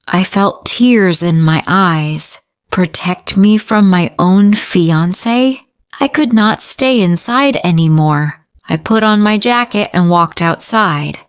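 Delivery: ordinary delivery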